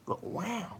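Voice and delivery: high, very soft nasal wow; Owen Wilson. Shown as a demonstration. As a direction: send a tiny wow gliding upward.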